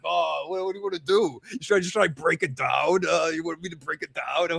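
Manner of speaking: slightly put-on, softened questioning voice; Randall impression. Co-host as Randall asking about the door, playful put-on voice. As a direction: play a helpful door breaker awaiting orders. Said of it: "And it's like".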